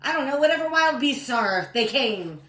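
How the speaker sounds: high, loud storytelling build-up; loud dramatic listing. A narrator's reading. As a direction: call out each beast with mounting alarm. Excitedly lists the wild beasts.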